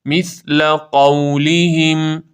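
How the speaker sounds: half-speed recited مثل قولهم; model recitation. Shown as a demonstration. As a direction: draw out مثل قولهم with a voiceless ث. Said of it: "سا نوک زبان".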